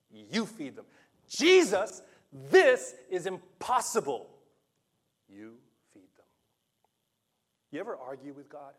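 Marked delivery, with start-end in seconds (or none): 1.3-4.3 loud, high, slow protest; exasperated outburst. Retold or imitated. Disciples protesting to Jesus, loud exasperated cry. 5.3-6.2 soft, steady whisper; whispered command. Retold or imitated. Jesus answering the protesting disciples in a calm whisper. Gives you the same answer.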